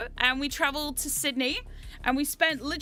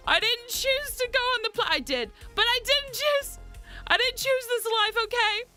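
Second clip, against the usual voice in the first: mock whining; 8 semitones higher than usual. Mock complaint about chat betting.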